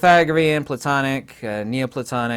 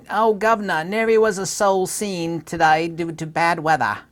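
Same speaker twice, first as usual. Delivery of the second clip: a higher, hammed-up olde-English voice; old English street talk; 5 semitones higher than usual. Imitating how Shakespearean folk spoke of a soul, hammed-up olde-English voice. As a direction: play a quaint Elizabethan passer-by. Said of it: "Shakespearean English".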